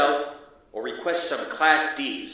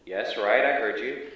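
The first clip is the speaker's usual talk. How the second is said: usual speaking voice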